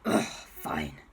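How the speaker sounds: low, rough villain groan; grudging surrender. Acted. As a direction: give in with a grumpy groan. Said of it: "And he went".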